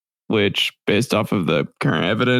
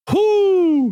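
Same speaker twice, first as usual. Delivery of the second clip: excited whoop. Real, not acted. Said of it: "hyping an opener".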